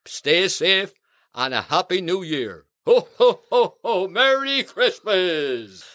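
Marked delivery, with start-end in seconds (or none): 2.9-4.1 loud, jolly Santa laugh; a big cheerful ho ho ho. Acted. 4.1-5.9 jolly Santa. Acted. Santa's sign-off.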